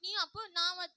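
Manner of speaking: high, very loud accusation; shouting back. Acted. Younger sister yelling an accusation at her sister. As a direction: yell it at her.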